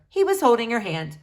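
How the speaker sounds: ordinary delivery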